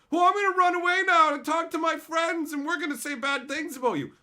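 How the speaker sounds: high, loud whiny mimicry; mock tantrum. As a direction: imitate a sore loser threatening to tattle. Mocking opponent threatening to tell friends.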